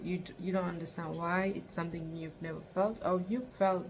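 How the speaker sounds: ordinary talk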